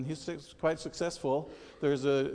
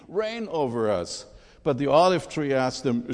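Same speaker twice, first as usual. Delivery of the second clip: loud, emphatic fable storytelling; dramatic scripture reading; 5 dB louder than usual. Acted. Preacher reads Jotham's fable of the trees aloud, dramatic storytelling.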